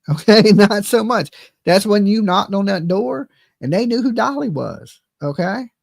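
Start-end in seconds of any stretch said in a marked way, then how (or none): 0.0-1.3 loud laughing dismissal. Real, not acted. Streamer mocking rival's standing in Tennessee, laughing. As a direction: chuckle through the put-down.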